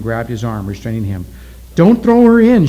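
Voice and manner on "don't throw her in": high, loud urgent cry; shout. A narrator's reading. Oki pleads for the girl at the volcano, shouting. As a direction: shout out in protest. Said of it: "shouted Oki".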